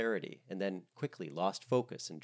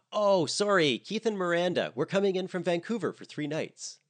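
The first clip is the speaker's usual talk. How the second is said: much higher, louder chirpy voice; cheery guest; 10 semitones higher, 7 dB louder than usual. Acted. A guest texts about arriving on the ferry, high and chirpy. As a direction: read it like a bubbly tourist.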